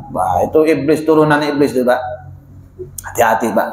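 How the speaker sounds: low warning. Real, not acted. Preacher warns the congregation about pride, low and hushed. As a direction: drop the voice to warn them.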